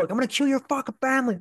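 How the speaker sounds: high, fast imitation of the gunman; retelling the armed intruder's threat, high and fast; retold threat. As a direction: spit it out quick like the man with the gun.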